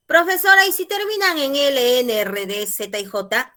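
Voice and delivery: high, loud pupil calling out; student's question. Acted. Student asks the teacher about endings, high loud query.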